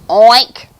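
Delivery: short, very loud oink; pig oink. Acted. Cow with the pig's sound, loud comic oink. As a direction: punch out a sudden oink. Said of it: "said the cow".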